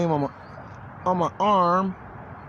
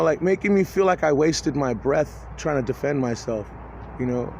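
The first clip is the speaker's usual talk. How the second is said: ordinary talk, nothing marked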